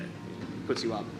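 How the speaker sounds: ordinary talk, nothing marked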